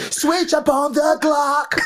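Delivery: very high, drawn-out goofy squeal; hammed-up high, slow chant; high-pitched mock voice. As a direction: stretch it out in a squeaky put-on voice. Joining the mock drawn-out bit.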